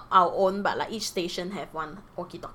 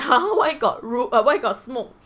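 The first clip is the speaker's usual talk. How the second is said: surprised; 4 semitones higher, 8 dB louder than usual. Retold or imitated. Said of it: "Then I was like".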